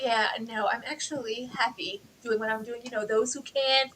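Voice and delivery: soft, put-on voice mimicking her self-assurance; soft, mocking impression of a fake-happy teacher. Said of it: "when she's like".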